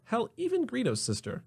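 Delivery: ordinary talk, nothing marked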